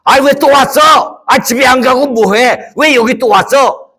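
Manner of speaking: loud, annoyed rich man snapping at a beggar. Acted. 부자가 거지를 쫓아냄. (짜증 내며 소리치는 부자)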